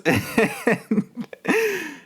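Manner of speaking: high giggly laugh; high laugh after confessing to roommates; nervous high laugh. Real, not acted.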